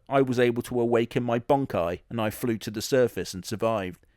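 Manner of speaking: normal talking voice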